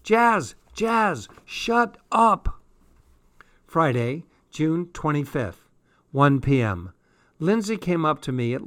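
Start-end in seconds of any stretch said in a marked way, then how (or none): 0.0-2.6 very high, urgent plea; high exasperated cry; mortified protest. A narrator's reading. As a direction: beg her to stop, squealing high. Mortified girl calls to friend.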